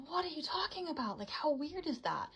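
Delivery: high, startled disbelief; incredulous. Retold or imitated. Telling the shop owner she has never been there, high and incredulous. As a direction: pitch up in baffled surprise.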